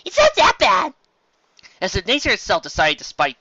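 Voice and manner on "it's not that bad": high, slow, sing-song chirp; high chirpy coaxing. Acted. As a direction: cheer up the gloomy friends. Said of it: "Pinkie Pie chirped".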